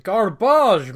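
put-on posh English accent, with a sharp loud punch; a mock posh English accent. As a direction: spit it out like an outraged English toff. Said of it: "I say it in a posh accent".

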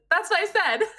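high, silly muppet voice; squeaky indignant puppet protest; imitating Clueless Morgan. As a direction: play the dim pirate muppet, insisting. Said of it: "And Clueless Morgan says".

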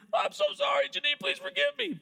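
very high, wailing mock apology; exaggerated tearful pleading; hammed-up repenting. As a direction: beg forgiveness in a comic high wail. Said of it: "I called up my wife and I repented".

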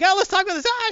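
very high, loud squeaky plea; panicked plea. Retold or imitated. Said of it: "she's like".

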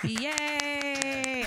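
soundbite cheer. Pretend. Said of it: "I'm doing the soundbite".

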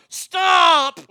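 very loud, high yelled command; yelling. Acted. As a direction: shout it in all caps. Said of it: "And he'll yell".

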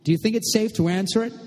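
high-pitched call down the stairs. A narrator's reading. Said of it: "calling down after a moment".

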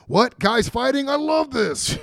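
high, loud mock excitement; hammed-up eager brawler; excited wrestler impression. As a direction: crash in thrilled, like a wrestler itching to brawl. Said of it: "music hits and he's like".

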